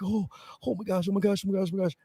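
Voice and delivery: soft, high, repetitive fretting; acted hushed panicking; retold private panic. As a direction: fret to yourself in a hushed high voice.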